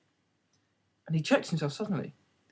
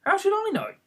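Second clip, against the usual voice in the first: surprised at own courage. A narrator's reading. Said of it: "surprised at her own courage".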